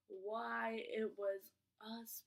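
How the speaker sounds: normal talking voice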